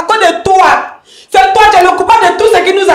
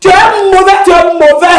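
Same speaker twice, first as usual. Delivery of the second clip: furious accusation; 3 semitones higher, 3 dB louder than usual. Acted. Wife blaming the partner as the devil, furious shouting. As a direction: hurl the blame at full voice.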